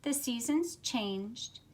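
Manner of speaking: put-on lively, expressive read-aloud for children; storybook reading. A narrator's reading. Teacher reads picture book aloud.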